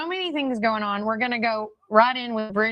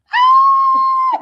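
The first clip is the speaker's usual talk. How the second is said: delighted shriek of laughter; 10 dB louder than usual. Real, not acted. Guest arrives in studio.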